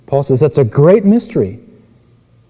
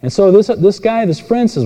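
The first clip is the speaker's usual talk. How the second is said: normal talking voice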